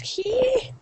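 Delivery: soft, high-pitched "hee" of dismay; high gasp. Real, not acted. Reacting to Chinese characters, soft high gasp. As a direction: let out a small high squeal.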